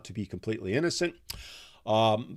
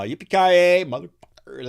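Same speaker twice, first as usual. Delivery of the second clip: movie catchphrase; 7 semitones higher, 8 dB louder than usual. Acted. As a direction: belt out the action-hero line, then drop off. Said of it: "There, I said it".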